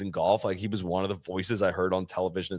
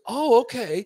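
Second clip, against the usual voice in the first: a higher, louder, pleasant oh okay; nice polite brush-off; 12 semitones higher, 6 dB louder, slower than usual. Retold or imitated. Bobby answering the podcast host, a higher polite oh okay. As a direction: imitate a friendly but final reply. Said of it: "in, like, a really nice".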